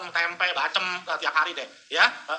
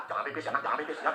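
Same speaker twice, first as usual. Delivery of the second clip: mock prayer; 7 semitones lower, 9 dB quieter, faster than usual. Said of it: "Sampai doa-doa".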